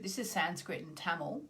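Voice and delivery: ordinary delivery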